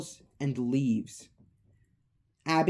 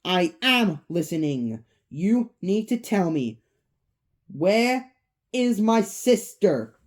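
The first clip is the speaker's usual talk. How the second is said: demanding; 3 semitones higher, 4 dB louder than usual. Acted. Mike confronts Vanessa on her doorstep, demanding. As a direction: demand an answer, punching each phrase. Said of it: "demands to know where Abby is".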